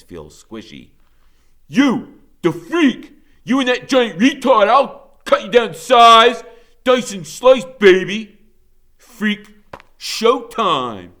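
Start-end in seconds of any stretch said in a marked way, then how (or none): 1.7-11.1 loud, high, menacing taunt; mean gang leader. A narrator's reading. Blade taunting Freak across the crowd, loud menacing sneer. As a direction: sneer and threaten like a street bully. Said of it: "his wicked laugh, so mean and dirty".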